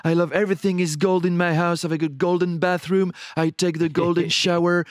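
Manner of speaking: Donald Trump. Retold or imitated. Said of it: "il était là".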